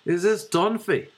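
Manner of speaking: high-pitched Dublin prank-call voice; Dublin accent. Retold or imitated. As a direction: put on a Dublin accent as the caller. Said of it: "I could do a Dublin one".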